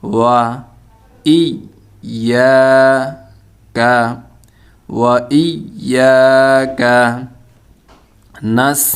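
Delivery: one syllable at a time with pauses between; halting piece-by-piece pronunciation demo; broken-up syllables. Shown as a demonstration. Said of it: "ভাঙা ভাঙা উচ্চারণ করি".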